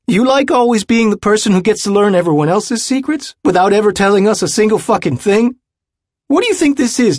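shrill, fast furious outburst; furious rant. Acted. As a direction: lash out, voice high and loud. JB's drunken tirade at Jude.

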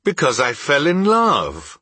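high, simple, heartfelt answer; earnest protest. Acted. Nephew explaining his marriage to his uncle, earnest and high. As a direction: answer with lifted, open earnestness.

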